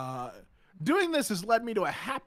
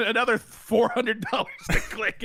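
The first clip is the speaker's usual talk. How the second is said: Grunkle Stan voice; 6 semitones higher, 5 dB louder than usual. Acted. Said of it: "Say thank you to [name] for his Grunkle voice".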